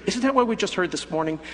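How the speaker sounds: ordinary talk, nothing marked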